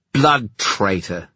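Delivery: slow, spitting contempt; spat insult. Acted. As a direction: spit the words with venom. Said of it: "He spat past the knife".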